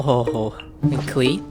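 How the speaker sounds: normal talking voice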